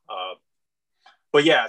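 ordinary talk, nothing marked

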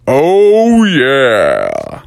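loud booming catchphrase; Kool-Aid man yell. Retold or imitated. Kool-Aid man yelling from the background, loud booming. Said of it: "Kool-Aid man yelling out".

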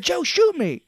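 very high, loud mock cry; hammed-up urging. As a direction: yell the pretend plea in a squeezed high voice. Retold plea, repeated bigger.

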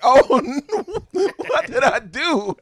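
high, loud laughing oops; mock dismay, laughing. Cane toad man sees his mistake, laughing mock horror. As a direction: laugh through a hammed-up panic. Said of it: "And he's like".

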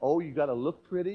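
usual speaking voice

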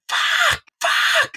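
piercingly high panicked shriek, acted out; imitated screaming. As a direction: mimic a friend screaming in terror. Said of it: "He starts screaming".

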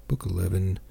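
ordinary delivery, nothing marked

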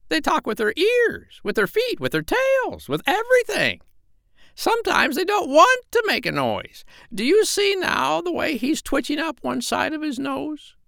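very high, squawky bossy parrot. Acted. Parrot Polynesia scolding the doctor, very high bird voice. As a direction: peck at him with a shrill bird voice. Polynesia explaining animal talk.

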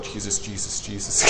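soft desperate under-breath plea; hushed panicked prayer. Retold or imitated. Preacher recalling being cornered, softly pleading Jesus. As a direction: breathe the name like a silent prayer.

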